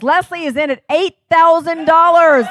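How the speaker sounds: shouted out high and loud as the new top bid; excited bid call. Real, not acted. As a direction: announce the bid like a thrilled auctioneer. Auctioneer announcing the top bid.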